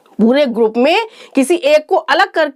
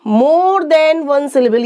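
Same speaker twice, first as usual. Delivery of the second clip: slow rule; much slower than usual. Shown as a demonstration. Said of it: "teacher stresses rule".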